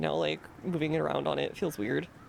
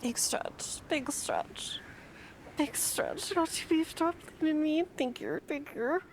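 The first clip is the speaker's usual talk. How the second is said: cutesy voice; 11 semitones higher, slower than usual. Pretend. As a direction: coo at them like pets. Cutesy voice to game characters.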